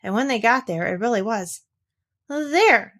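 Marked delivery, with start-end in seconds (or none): 2.3-2.9 proud. A narrator's reading. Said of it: "said Eeyore proudly".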